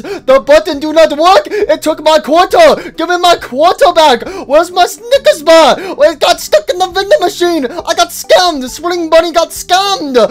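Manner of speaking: high, loud complaining cartoon bunny; a squeaky bunny yelling about a lost quarter; high whiny bunny voice. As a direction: whine like a cheated toy rabbit. Voices Spring Bunny at vending machine.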